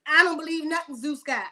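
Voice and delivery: normal talking voice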